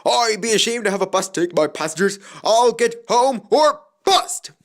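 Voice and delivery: brave promise. A narrator's reading. Skarloey to his driver, loud brave vow. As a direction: swear to make it home. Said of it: "he promised bravely".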